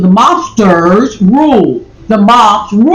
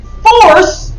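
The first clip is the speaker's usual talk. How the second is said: "force" pitched up and drawn out; stress on force; 9 semitones higher than usual. Real, not acted. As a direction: punch the word "force" like capital letters. Reads out a word in all caps.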